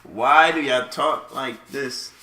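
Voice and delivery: slow exasperated question. Retold or imitated. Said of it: "I'm just sitting there like".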